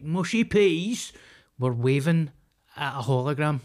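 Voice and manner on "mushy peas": high, loud, drawn-out silly cheer; mocked royal fan. Co-host mocks crowd waving at hologram, silly high cheer. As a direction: send up a daft crowd's cheer.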